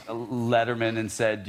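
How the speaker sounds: normal talking voice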